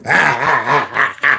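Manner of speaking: low mocking cackle; mocking laugh. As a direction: laugh down at the teacher. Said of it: "And he had this laugh".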